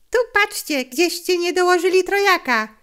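put-on high, loud boy's voice; loud, insistent challenge; sharp protest. A narrator's reading. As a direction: call out the short count. Boy disputes the dealer's count.

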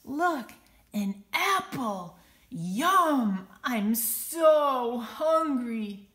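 loud, greedy glee, slow; greedy bully delight. Acted. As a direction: gloat over the apple, loud and slow. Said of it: "Benny says with delight".

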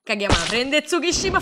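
usual speaking voice

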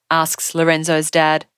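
ordinary delivery

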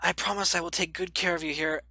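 voicing Toriel. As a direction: Toriel gently begging the child to go upstairs. Reads Toriel's lines aloud.

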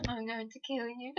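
creepy soft threat. Acted. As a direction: murmur it like a stalker. Obsessed fangirl threatens listener.

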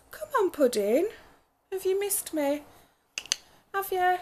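high cooing pet voice. Real, not acted. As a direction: coo up high at the cat. Greeting her pet after trip.

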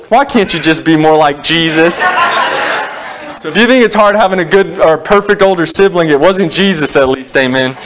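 rising gospel fervor from the pulpit; fervent preaching. Acted. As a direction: lift the congregation with the story. Raised preaching of gospel story.

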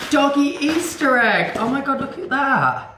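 high, laughing gush; high pet talk with a laugh; delighted coo. Real, not acted. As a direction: squeal over a cute treat. Showing the dog his present.

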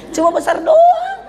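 high mock retort. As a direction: squeal it out slowly like a cheeky excuse. Mock reply, punchline. (nada tinggi pelan, bercanda membela diri)

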